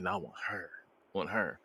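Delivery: very low, soft voice; retold murmur. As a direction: drop way down in pitch and volume. Retold pick of a woman.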